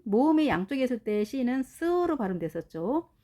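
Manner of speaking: ordinary talk, nothing marked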